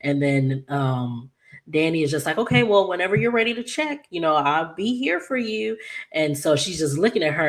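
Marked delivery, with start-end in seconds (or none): 2.4-5.8 high gentle reassurance. Retold or imitated. Danny reassuring Naomi, high gentle coaxing. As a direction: coax her gently, all sympathy.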